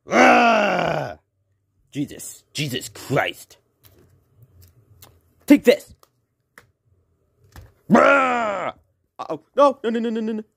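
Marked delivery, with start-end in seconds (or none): 0.1-1.2 a monster roar in a put-on voice; dinosaur roar. Dinosaur attacks the players. 7.9-8.7 a loud, high monster roar; dinosaur roar. Acted. Dinosaur attacks the players.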